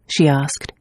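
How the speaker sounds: plain reading voice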